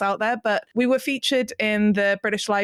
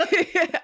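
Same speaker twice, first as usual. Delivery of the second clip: quick high giggle; a short burst of laughter; 10 semitones higher than usual. Real, not acted. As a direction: giggle between words. Guest laughs mid-sentence.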